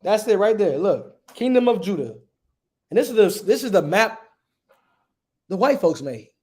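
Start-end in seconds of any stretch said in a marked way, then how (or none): none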